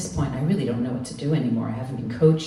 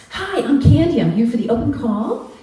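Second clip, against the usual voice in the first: much higher, bubbly mock model voice; imitating a chirpy aspiring model, high and bright; perky ingenue; 12 semitones higher, 7 dB louder than usual. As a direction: play the eager hopeful, pitched way up. Imitating eager model Candy.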